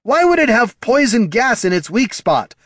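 high-pitched incredulous protest. A narrator's reading. Bard questioning dwarf about poison gas, incredulous. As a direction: ask it in sheer disbelief. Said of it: "Heraldon asked incredulously".

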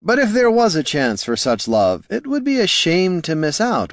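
high lilting tease; lilting bard. A narrator's reading. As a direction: coax her gently. Bard teasing romance.

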